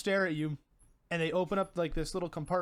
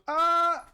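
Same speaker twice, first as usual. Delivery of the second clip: high, loud imitated scream; a sudden high "Ah!" as if sucked away; cartoonish yell of alarm; mock scream; 12 semitones higher, 8 dB louder than usual. Butter sucked into vacuum.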